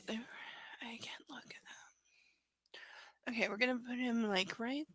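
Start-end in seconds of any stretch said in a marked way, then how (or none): 0.1-3.3 whisper. Real, not acted. Aside while arranging ducks.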